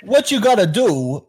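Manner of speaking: high, slow Walken cadence; slow, odd Walken emphasis; Walken impression. Shown as a demonstration. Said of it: "that voice".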